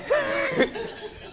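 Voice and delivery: a high burst of laughter. Real, not acted. About to quote the repossession warning, high laugh. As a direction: laugh up high at the absurd line.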